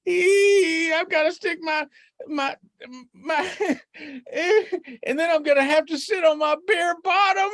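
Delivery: high, whimpering rabbit voice; dreading Rabbit. Acted. Rabbit dreads the cold water, high whimpering. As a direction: squeal and whine at the thought.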